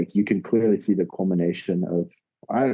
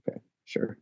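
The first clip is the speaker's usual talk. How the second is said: whispered; 10 dB quieter than usual. Retold or imitated. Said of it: "to the point where I'm like".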